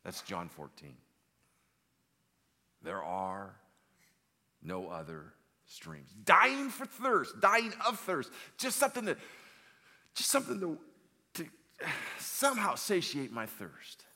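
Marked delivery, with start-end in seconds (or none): 2.8-6.1 measured words with long pauses; solemn slow pronouncement. Acted. Preacher repeating Aslan's answer to the congregation, slow and solemn. 6.2-14.0 high, loud pleading outburst; desperate cry. Acted. Preacher acting out a soul dying of thirst, loud desperate cry.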